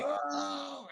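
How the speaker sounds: high, soft, hammed-up falling yelp; mock scream. Actor falling into the garbage on camera, hammed-up high yelp. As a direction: yelp as if the bin swallows you.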